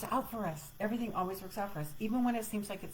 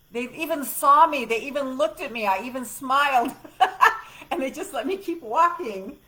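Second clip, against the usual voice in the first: laughing; 7 semitones higher, 10 dB louder than usual. Real, not acted. Laughing at slipping past nurses.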